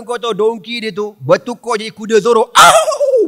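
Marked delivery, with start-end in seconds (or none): 2.5-3.3 high, loud laughing yelp; mock whinny yelp. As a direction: shriek like a leaping horse. Horse transforms, cry.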